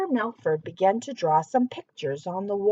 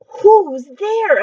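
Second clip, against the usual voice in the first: high, loud call; knight calling out; 7 semitones higher, 11 dB louder than usual. Acted. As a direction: call into the dark. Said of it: "asked Sir Melford".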